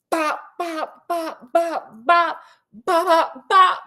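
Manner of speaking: comic cane-strike noises, rising to a laugh; high mock bop sounds. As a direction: beat out each bop like cane blows. Imitating cane beating sounds.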